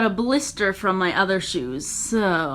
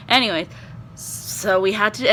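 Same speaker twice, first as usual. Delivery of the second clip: normal talking voice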